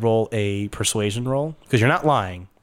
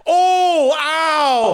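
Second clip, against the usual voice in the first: high, loud mock cry of pain; hammed-up wounded wailing; fake pain howl; 5 dB louder than usual. Game master as grunt faking a head wound, fake pain howl. Grunt fakes being hurt.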